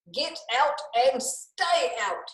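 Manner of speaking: a storybook telling-off, slow; slow hammed-up order to get out; mild angry shout. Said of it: "he shouted".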